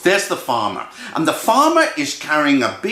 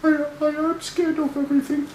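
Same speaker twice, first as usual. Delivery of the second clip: tiny high-pitched timid squeak; Freddy Mouse; 12 semitones higher, faster than usual. Acted. Freddy confesses to his brothers, small timid squeak. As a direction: stammer it out in a small, fast, high voice. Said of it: "And Freddy Mouse said".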